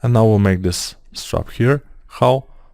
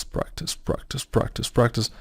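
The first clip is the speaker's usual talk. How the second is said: whispered repetition; 10 dB quieter than usual. Acted. Speaker stressing to viewers that practice matters, whispered. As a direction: drop to a whisper, repeating practice.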